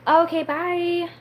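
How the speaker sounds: high, slow sing-song farewell; lilting, drawn-out sign-off; drawn-out goodbye. Real, not acted. Sing-song goodbye to a departing viewer.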